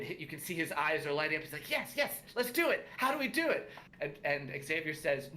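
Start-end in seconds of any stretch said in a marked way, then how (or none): 1.7-3.7 high-pitched eager little student; excited halfling pupil. Acted. Pupil jumps at the teacher's offer, high and excited. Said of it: "Drynan looks incredibly excited".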